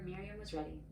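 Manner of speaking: ordinary delivery, nothing marked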